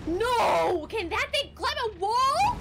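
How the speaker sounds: loud, high panicked yell; panicked shout. Real, not acted. Player seeing the monster scale a wall, yelling in panic. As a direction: scream it as the monster closes in. Monster climbing toward him in game.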